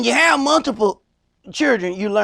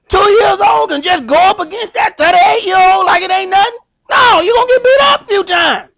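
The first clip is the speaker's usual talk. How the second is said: high, loud hammed-up crying child; loud mock crying shout of a small child; mock toddler wail; 10 semitones higher, 7 dB louder than usual. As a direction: wail like a beaten two-year-old. Acting toddler beaten, crying.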